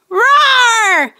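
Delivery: hammed-up toy roar; playful dinosaur roar. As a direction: give the T-Rex a big roar.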